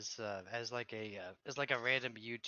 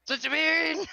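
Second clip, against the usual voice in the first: high, loud anime attack yell; loud battle cry; 13 dB louder than usual. Pretend. Mock attack shout.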